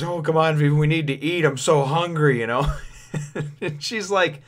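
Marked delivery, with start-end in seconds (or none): 0.0-2.4 lightly voiced hungry pleading; Luffy begging Vivi for lunch, mock whine; whiny begging. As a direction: beg for food like a kid. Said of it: "Luffy says".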